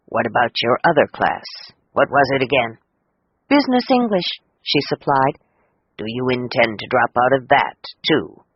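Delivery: a hint of a low, concerned teacher. A narrator's reading. Teacher asks Cecilia about her other class, gently low. Teacher voiced lower, man.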